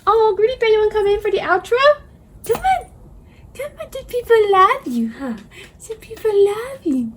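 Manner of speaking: put-on very high, cooing baby talk to a dog; puppy voice. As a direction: coo like talking to a puppy. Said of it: "Sorry for my puppy voice".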